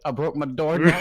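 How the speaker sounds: high, very loud retold outcry; mock loud wail. Retelling his friend's return from breaking the door, loud mock dismay. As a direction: blurt it out in comic dismay.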